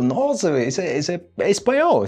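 high, amazed exclamation; light mock surprise at spotting Spanish; surprised realisation. Teacher recalling reading the book, amazed. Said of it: "Outra também que quando eu vi, eu".

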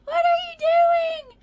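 high, loud, drawn-out shouting through laughter; exasperated laughing squeal; mock scolding. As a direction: scold a toddler with a playful, escalating yell. Mock scolding naked toddler.

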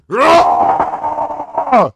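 loud shrieking laugh. Real, not acted. Laughing hard at baby.

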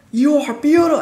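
high, loud mock shout of a compliment; retelling the deaf man praising her, high and loud. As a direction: bellow it sweetly like a deaf old man. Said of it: "and he's like".

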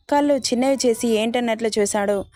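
plain reading voice

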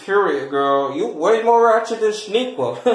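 ordinary talk

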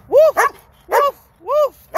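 high, loud playful woofs; the Lord speaking dog language, barking loud and high. Retold or imitated. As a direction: bark like a happy dog. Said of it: "In dog language, he says".

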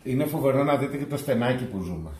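ordinary talk

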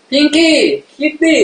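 sing-song coo at the kitty; cooing to a cat. Real, not acted. Streamer coos pinky kitty to the cat, high and playful.

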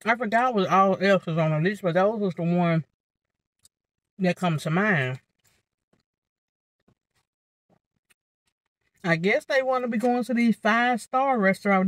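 chewing between words; talking while eating. Real, not acted. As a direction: mouth partly full. Seen 2: Holds fork, repeatedly brings food to mouth while talking, chewing.

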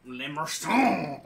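slow, loud French accent, drawn out. Shown as a demonstration. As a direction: say it like a hammy Frenchman. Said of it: "French accent".

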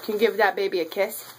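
usual speaking voice

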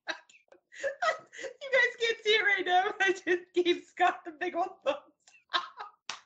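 high, squealing through helpless laughter; laughing to tears. Real, not acted. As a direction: struggle to get words out through real laughter. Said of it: "I'm crying because I'm laughing so much".

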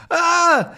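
high, loud yelp of fright; a sharp scared yelp; startled cry. Acted. Haddock snatching his hand from the shark, high yelp.

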